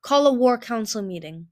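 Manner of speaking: loud royal order; commanding. A narrator's reading. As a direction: issue a firm command. Queen issues a command.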